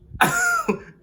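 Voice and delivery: high laugh. Real, not acted. Loud laugh.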